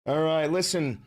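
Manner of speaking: usual speaking voice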